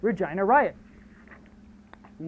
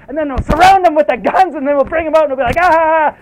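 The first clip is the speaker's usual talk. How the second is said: higher and louder, gleefully shouting the scheme; excited plan shout; 8 semitones higher, 11 dB louder than usual. Retold or imitated. A schemer bellowing his plan to the others, loud and excited. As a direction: ramp it up into a triumphant yell.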